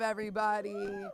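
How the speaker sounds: ordinary talk, nothing marked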